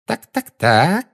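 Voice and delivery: slow, gloating drawl; triumphant. A narrator's reading. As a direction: savour each word smugly. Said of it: "с торжествующей миной сказала". (торжествующе)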